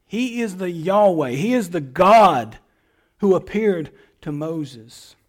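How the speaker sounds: usual speaking voice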